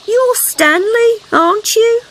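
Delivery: high, slow, unsure question; a mum who can't tell her kids apart; mother frog voice. A narrator's reading. Mother frog guessing name.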